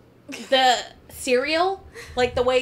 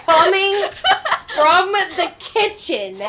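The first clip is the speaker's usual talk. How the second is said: higher and louder through laughter; laughing outrage; 6 semitones higher, 6 dB louder than usual. Real, not acted. Complaining to a friend about a slurping man, laughing outrage. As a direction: laugh while you complain.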